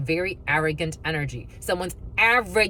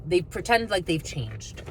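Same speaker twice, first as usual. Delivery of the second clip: ordinary talk, nothing marked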